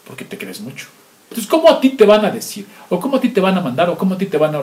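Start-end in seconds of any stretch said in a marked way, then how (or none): 0.1-0.9 whispered aside. Acted. Preacher whispers a jab at the proud listener. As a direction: drop to a whisper, mocking the proud.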